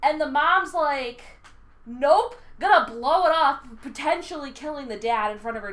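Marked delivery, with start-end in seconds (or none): 1.9-3.6 defiant. Retold or imitated. Mom voiced defiantly in retelling.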